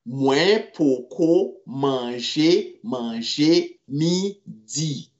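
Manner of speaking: very slow, each word separated; slow teaching repeat. Shown as a demonstration. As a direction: say poko manje slowly so students can follow.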